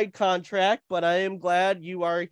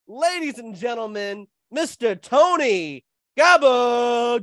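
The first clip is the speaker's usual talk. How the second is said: ring announcer; 3 semitones higher than usual. Acted. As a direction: stretch the name like a boxing announcer. Wrestling-style name announcement.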